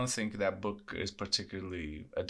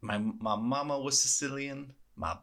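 put-on thick Italian-American accent, a bit higher; 4 semitones higher than usual. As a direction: play up the Sicilian mobster voice. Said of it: "sort of Italian-American accent".

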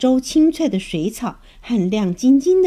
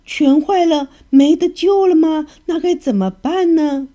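panicked; 7 semitones higher, 5 dB louder than usual. A narrator's reading. Grandma panics about the teeth.

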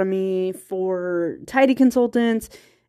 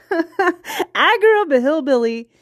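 drawn-out hillbilly accent, pitched up; country accent; 7 semitones higher, slower than usual. Real, not acted. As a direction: lean into a slow small-town Virginia country accent, amused. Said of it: "with a very thick country accent".

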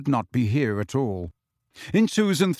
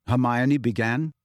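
ordinary delivery

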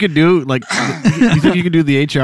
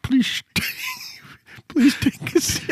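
laughing through words; 8 semitones higher, 7 dB quieter than usual. Real, not acted. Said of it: "I can't get it".

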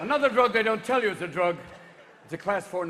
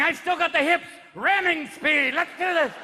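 loud, high battle-ready bravado; cocky penis character; 9 semitones higher, 4 dB louder than usual. Acted. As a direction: order the charge, gung-ho. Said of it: "your penis is going".

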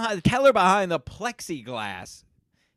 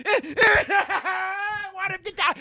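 laughing through it; 12 semitones higher, 4 dB quieter, much slower than usual. Real, not acted. Rant breaks into laughter.

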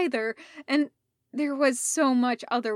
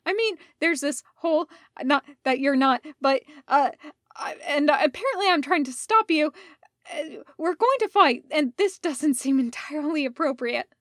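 flustered babble; 4 semitones higher, 6 dB louder, faster than usual. A narrator's reading. Rodney babbling nervously during seduction.

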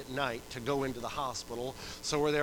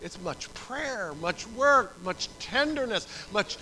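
loud, high pulpit exhortation; full-force preaching; 7 semitones higher, 12 dB louder than usual. Real, not acted. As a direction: hammer it home to the congregation.

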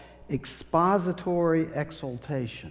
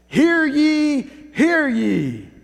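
loud, high proclamation call; town crier; 11 semitones higher, 8 dB louder, slower than usual. Acted. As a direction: bellow it across the town square. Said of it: "a town crier".

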